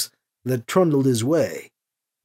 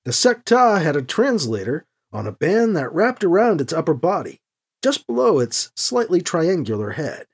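plain reading voice

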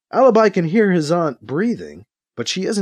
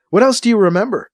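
eager question; 7 semitones higher, 5 dB louder, faster than usual. A narrator's reading. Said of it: "Alibi asks her, hoping for more".

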